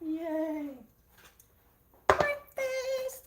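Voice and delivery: high, loud happy call of breakfast; delighted breakfast call; excited cheer. Real, not acted. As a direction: sing out that the food's ready. Happy announcement.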